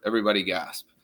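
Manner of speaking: ordinary delivery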